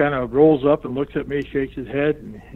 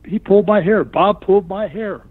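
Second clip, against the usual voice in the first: high, whining tattletale to the ref; whiny complaint; 7 semitones higher than usual. Retold or imitated. A wrestler whining to the referee about hair pulling, in a high whine. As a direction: tell on him like a wronged kid.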